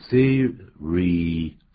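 "serious" broken into see, ree, us; drawn-out syllable-by-syllable "serious"; three separate syllables. Shown as a demonstration. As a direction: sound out "serious" slowly, one syllable at a time. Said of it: "它念起来是三个音节啊".

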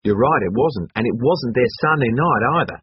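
high, loud and eager; lively character voice, remembering aloud; Hatchet, brash student. Acted. Hatchet's voice in the story.

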